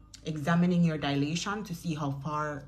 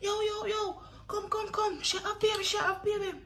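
very high, urgent summoning; high-pitched hammed-up commotion; imitating excited hospital staff. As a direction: call security over in a high mock panic. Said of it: "They call the security".

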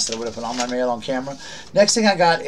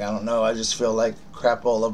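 ordinary talk, nothing marked

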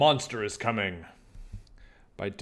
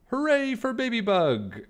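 much higher, louder joyful cheer; 14 semitones higher, 5 dB louder than usual. Acted. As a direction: everyone celebrating their hero. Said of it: "everyone cried".

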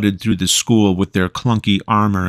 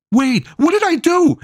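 much higher, desperate cry; panicked scream. Acted. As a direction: scream it as they grab you. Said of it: "Lucas screamed".

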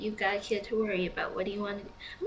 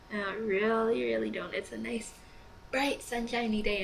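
ordinary delivery

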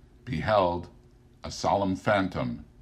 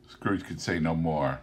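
ordinary delivery

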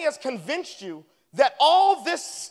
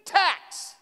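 higher and louder, mock-exasperated protest; retold exclamation; 8 semitones higher, 4 dB louder than usual. Pastor replaying his retort to a critic, higher and louder. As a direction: throw it back at him, half-exasperated. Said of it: "I was like".

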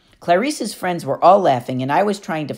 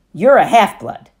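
louder, firm statement on you're; firm declaration; 5 semitones higher, 8 dB louder than usual. Acted. Annabeth tells Percy what he is, firm and loud. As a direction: state it flatly, no room for doubt.

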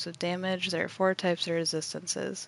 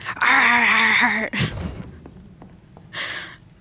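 burst of laughter; 4 semitones higher, 10 dB louder than usual. Real, not acted. Laughing at enemy's noise.

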